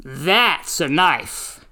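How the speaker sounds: low, slow Australian impression; slow smug Australian, savouring the line; Crocodile Dundee drawl. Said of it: "and says".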